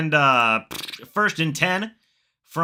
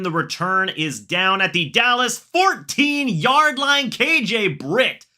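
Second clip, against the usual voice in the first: play-by-play call; 6 semitones higher than usual. Acted. Streamer calling the kickoff for his chat, excited play-by-play. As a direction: call the opening kickoff like a broadcaster.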